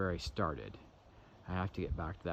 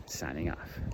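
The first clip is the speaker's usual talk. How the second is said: whisper; 3 semitones lower, slower than usual. Real, not acted. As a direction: breathe the goodbye with no voice. Whispered sign-off in the woods.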